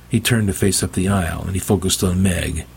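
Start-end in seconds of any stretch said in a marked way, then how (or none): none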